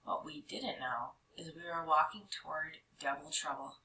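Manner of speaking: plain reading voice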